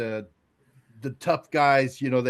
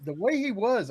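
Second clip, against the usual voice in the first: ordinary talk, nothing marked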